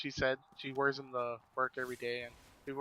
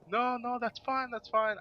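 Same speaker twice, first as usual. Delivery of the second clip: reassuring; 9 semitones higher than usual. Retold or imitated. Retold reassurance.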